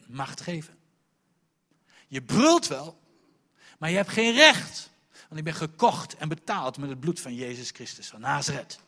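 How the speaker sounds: loud rebuking preacher; fiery sermon declaration; preaching at full force. Acted. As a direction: rebuke Satan from the pulpit. Preaching at Satan.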